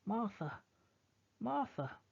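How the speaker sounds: high, ringing calls through the woods; calling out. Acted. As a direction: call her name from afar. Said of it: "They are calling".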